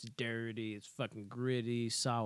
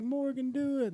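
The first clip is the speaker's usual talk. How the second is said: much higher and louder, egging him on; mock urging shout; 14 semitones higher, 5 dB louder than usual. Girls urging Morgan to shoot, high mock shout. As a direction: shout at Morgan to pull it, pitched way up. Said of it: "and they're just like".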